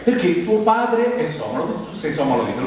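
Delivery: ordinary talk, nothing marked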